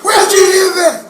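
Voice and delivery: high, loud indignant teacher's demand; shocked teacher. Retold or imitated. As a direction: act out an appalled teacher questioning a pupil. Shocked teacher confronting boy, retold.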